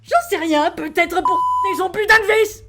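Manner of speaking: loud retort building in pitch; irritated shout; exasperated yelling. Acted. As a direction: yell back, fed up. Angry retort to passenger.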